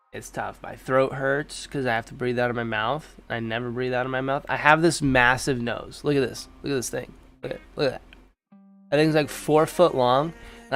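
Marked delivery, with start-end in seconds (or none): none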